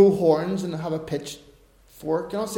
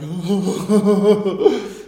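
1 laugh; 6 dB louder than usual. Real, not acted. Laughing at own prank.

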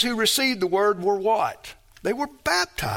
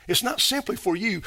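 ordinary delivery

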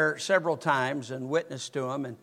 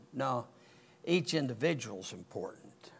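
whispered; 6 semitones lower, 9 dB quieter than usual. Real, not acted. Preacher tells the congregation each one matters, in a whisper.

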